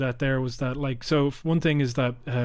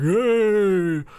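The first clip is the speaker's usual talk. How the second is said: higher, louder mock heckle; Westminster jeer; 6 semitones higher, 5 dB louder than usual. Mocking MPs heckling Starmer across the chamber, higher and louder. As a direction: bray it like a backbencher jeering. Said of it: "in that fucking dumbass Westminster way".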